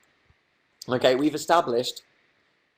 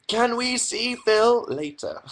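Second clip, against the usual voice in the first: mock high read; 12 semitones higher, slower than usual. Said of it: "reading chat question".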